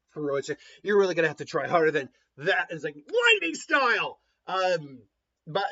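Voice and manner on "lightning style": jutsu battle cry. Acted. Sasuke calling out jutsu.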